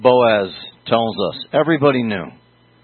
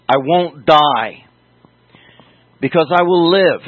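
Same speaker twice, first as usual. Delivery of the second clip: emphatic declaration; 3 semitones higher, 6 dB louder than usual. Acted. Psalmist's defiant declaration.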